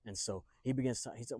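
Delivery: plain reading voice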